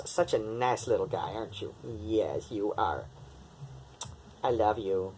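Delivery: quiet tender pet talk; soft cooing. Real, not acted. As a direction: murmur sweetly to the cat. Cooing softly to cat.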